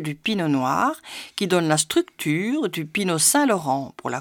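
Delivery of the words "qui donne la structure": ordinary delivery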